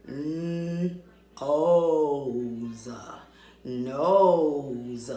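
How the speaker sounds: Nose sounded out slowly, N then O then whole; N-, O's, then Nose said whole; blending sounds. Shown as a demonstration. Teacher blending nose slowly with the class. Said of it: "Let's just blend the word nose".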